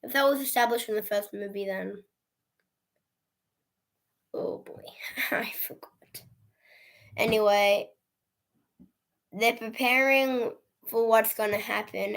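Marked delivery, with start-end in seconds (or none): 4.3-6.2 soft whispered embarrassment; hushed sheepish whisper; whispered aside. Real, not acted. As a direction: let it slip under the breath.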